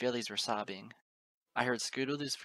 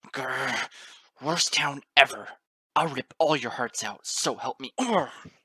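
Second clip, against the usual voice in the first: loud, growling enraged rant; struggling rant; 3 semitones higher, 11 dB louder, slower than usual. A narrator's reading. Father shoved into the soil, loud struggling rage. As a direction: rave and grunt while struggling. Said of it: "He could only struggle and moan".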